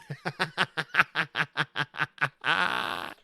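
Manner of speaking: helpless real laughter; can't hold the laugh in; burst of laughter. Real, not acted. Laughing at the developer's spec sheet, real and helpless. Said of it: "I don't mean to laugh".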